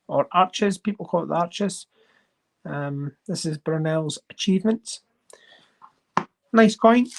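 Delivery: usual speaking voice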